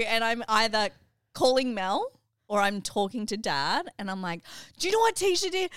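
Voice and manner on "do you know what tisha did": mock outraged quote. Sister retells ranting to Dad.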